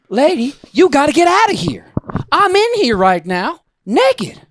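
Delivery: retold outraged yelling; indignant protest. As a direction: tell her off, appalled. Retold confrontation while naked.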